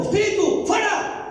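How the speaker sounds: Jesus rebuking the demon with a shout; commanding shout. Retold or imitated. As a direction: shout the spirit out.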